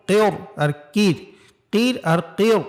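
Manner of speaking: কেয়র with a thick র, then কির with a thin র; heavy vs thin ra. Shown as a demonstration. Teacher contrasts the two sounds, heavy then thin. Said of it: "পার্থক্য বুঝতে পারছেন".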